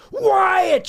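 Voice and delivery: mock scream, pitched very high; high-pitched comic shriek. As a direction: imitate an outraged fan screeching a name. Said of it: "she's screaming".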